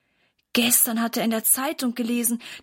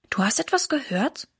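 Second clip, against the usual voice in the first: ordinary delivery, nothing marked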